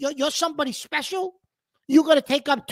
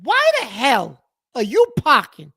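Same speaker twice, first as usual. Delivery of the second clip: higher, louder exasperated yelling at the driver; angry rant; 5 semitones higher, 5 dB louder than usual. Real, not acted. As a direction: tear into the guy who took two spaces. Ranting at double-parked driver.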